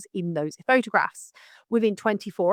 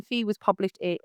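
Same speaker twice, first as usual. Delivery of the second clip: ordinary talk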